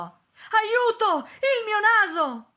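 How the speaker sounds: high, very loud scream of alarm; horrified cry for help. A narrator's reading. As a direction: cry out in panic at a shocking discovery. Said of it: "allo specchio gridò".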